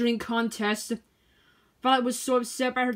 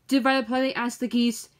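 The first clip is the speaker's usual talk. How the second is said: usual speaking voice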